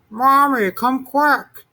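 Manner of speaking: high, loud little monster calling. A narrator's reading. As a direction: call for mommy from bed. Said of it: "he called".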